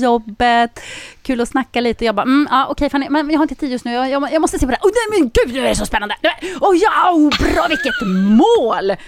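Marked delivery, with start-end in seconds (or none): 2.2-4.9 high, hurried brush-off; retold rude brush-off. Sports fan brushing off a chatty colleague, rushed and high. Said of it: "otroligt otrevlig mot dig". 4.9-9.0 high-pitched, gushing fan exclamations; imitated match excitement. Fan glued to the TV ignoring colleague, excited high cries.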